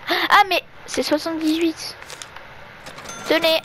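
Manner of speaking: normal talking voice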